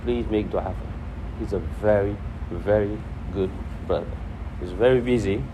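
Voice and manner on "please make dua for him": ordinary delivery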